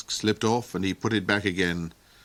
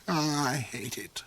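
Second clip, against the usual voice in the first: snarled; 5 semitones higher, 5 dB quieter than usual. Acted. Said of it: "snarled the Duke".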